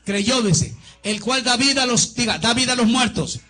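loud, high, fervent preaching; rousing pulpit call-and-response; preaching at full force. Acted. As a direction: preach it out to the whole church.

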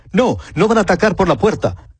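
a lookout calling down a warning, lightly acted; watchman's call. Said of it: "De nuevo el atalaya grita".

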